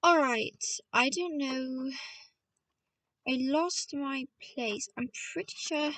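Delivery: ordinary delivery, nothing marked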